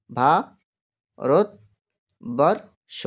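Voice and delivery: ভা-রত-বর-ষ split into four slow beats, ভা, রত, বর, ষ; syllable by syllable. Shown as a demonstration. Teacher sounding out ভারতবর্ষ for the class, slowly split. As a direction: say ভা-রত-বর-ষ very slowly, a pause after each syllable. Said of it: "চারটি ঝোঁক".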